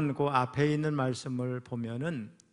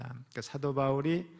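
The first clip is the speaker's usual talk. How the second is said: ordinary talk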